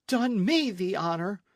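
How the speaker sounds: outraged echo, high and loud; angry cry. A narrator's reading. Señorita answers the captain's proposal, angry and upset. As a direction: throw it back angrily. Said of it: "cried angrily and almost in tears".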